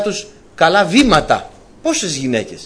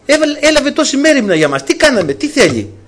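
Elisha's lines repeated much higher and brighter-pitched, glad and eager; cheerful prophet; 9 semitones higher than usual. Retold or imitated. Elisha calls to his servant, delighted by the woman's care, high and excited. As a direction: lift it way up, delighted at her kindness. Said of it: "φωνάζει το δούλο του και του λέει".

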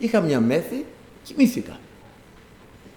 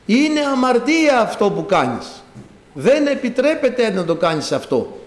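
John crying out; 5 semitones higher than usual. Retold or imitated. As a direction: call out each prohibition emphatically from a prison cell. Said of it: "μέσα από τα βάθη της φυλακής φώναζε".

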